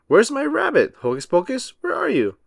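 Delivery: high, anxious calling out; calling for rabbit. A narrator's reading. Magician calling for his lost rabbit, anxious and high. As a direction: call for the missing rabbit.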